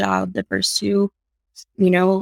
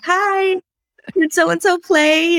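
much higher, slow and sweet, playing innocent; innocent kid voice; 9 semitones higher, 4 dB louder, much slower than usual. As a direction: act like a kid pretending to ask about a playmate. Kid fishing for a dinner invite.

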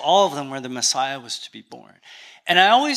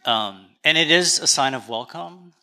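ordinary talk

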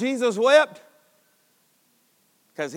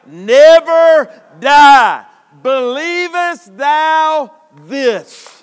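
drawn-out, loud and high; slow loud proclamation; 5 semitones higher, 8 dB louder, much slower than usual. Acted. Preacher voicing Jesus to Martha, slow and loud. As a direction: stretch it out, ringing.

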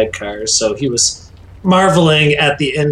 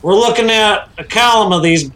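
megaphone voice; 5 semitones higher than usual. Acted. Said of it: "find a megaphone effect to put on your voice".

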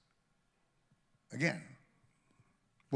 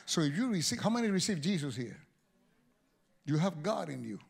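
normal talking voice

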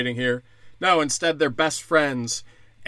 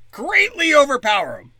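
high, loud cartoon-villain relish on greatly; greatly hammed up, much higher and louder; mock villain gloat; 12 semitones higher, 7 dB louder than usual. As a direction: sneer it out like a gleeful overlord. Mock villain boasting plan.

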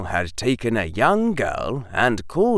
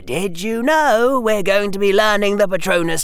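put-on higher boy's voice, emphatic; persuasive, earnest schoolboy; 9 semitones higher, 4 dB louder than usual. Harry urges Hermione to join the armies, keen and persuasive. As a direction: win her over with eager, pressing arguments.

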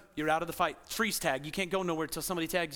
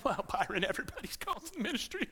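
mocking pastor; 6 dB quieter, faster than usual. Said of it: "having a pastor go".

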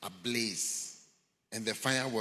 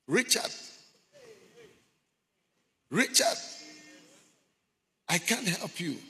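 high, loud acted cry; retold desperate shout; calling out; 12 semitones higher, 5 dB louder than usual. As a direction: shout the name up high, then plead helplessness. Voice calling out to Richard.

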